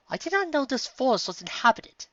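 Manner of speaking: surprised character voice. Acted. Octavia amazed the forest has inhabitants. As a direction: marvel at the discovery.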